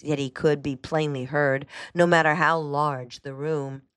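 ordinary delivery, nothing marked

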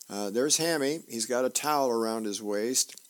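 ordinary delivery

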